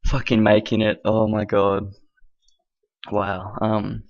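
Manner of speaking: ordinary delivery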